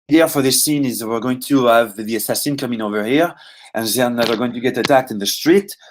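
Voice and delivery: light French accent, mimicking the French developers; a hint of a French developer's accent; imitating French accent. French developer explaining a scene to actors, in a light mock French accent. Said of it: "I started kind of imitating their way of talking".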